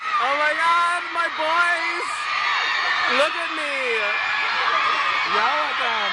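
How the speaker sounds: high, loud fangirl screaming; screaming fan. Real, not acted. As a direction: scream at your idols. Screaming at [name] arriving.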